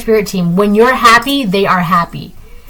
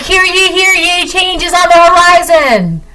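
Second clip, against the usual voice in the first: town crier; 8 semitones higher than usual. Pretend. Mock proclamation of coming change.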